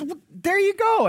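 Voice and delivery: high, loud broken-English exclamation; broken English accent. Retold or imitated. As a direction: imitate the shopkeeper's broken English, shooting up high. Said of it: "in his broken English accent".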